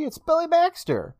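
high-pitched mock villain; singled-out, high flourish on the big reveal; taunting reveal.

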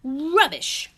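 loud, high grumpy dismissal; sharp retort. A narrator's reading. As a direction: throw it back crossly. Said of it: "retorted Mister Grumpy".

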